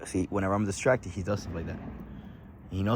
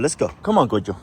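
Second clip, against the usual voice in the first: ordinary talk, nothing marked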